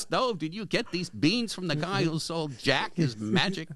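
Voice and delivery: lightly voiced New York lawyer; host quoting the film, lightly New York accented; hint of a New York accent. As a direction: quote the movie line with a touch of New York. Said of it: "you're doing with the accent".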